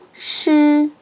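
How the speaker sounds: 시 as retroflex "sh", tongue curled up. Shown as a demonstration. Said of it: "시옷을 혀를 올리고 소리".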